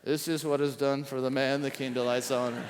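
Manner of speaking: fast, low, lightly voiced herald crying out; a hint of a herald announcing the king's honor; herald's proclamation. A narrator's reading. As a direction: rattle it off like a royal crier. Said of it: "proclaiming before him".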